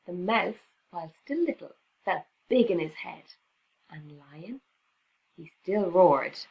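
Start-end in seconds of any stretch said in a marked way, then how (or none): 3.9-4.6 whispered name; soft whispered aside. A narrator's reading. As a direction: lean in and whisper. Hushed narration.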